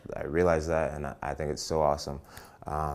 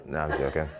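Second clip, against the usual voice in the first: ordinary delivery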